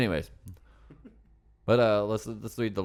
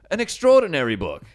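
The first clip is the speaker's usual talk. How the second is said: much higher and louder dramatic announcement; booming review read; 8 semitones higher, 11 dB louder than usual. Pretend. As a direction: proclaim it like a trailer voice. Reading review in put-on voice.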